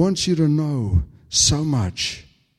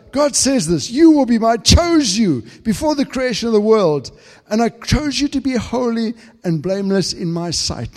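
much higher, louder impassioned preaching; fervent pulpit climax, climbing louder; full force preaching; 9 semitones higher, 7 dB louder than usual. Acted. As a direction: cry it out over the congregation. Preaching God's words.

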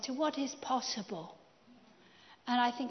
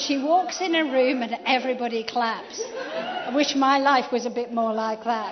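chuckling through the words, louder; laughing; 7 dB louder than usual. Real, not acted. Host joking about his guest's wife, laughing through it. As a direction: laugh as you joke about her entrance. Laughing over wife's applause.

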